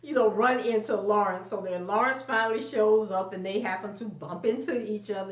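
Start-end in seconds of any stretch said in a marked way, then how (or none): none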